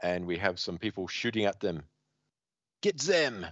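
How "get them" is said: high, hammed-up French command; bad comic French accent, pitched up; outrageous French accent. Attacker shooting at heroes, outrageous high French accent. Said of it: "It's hard to do the outrageous French accent".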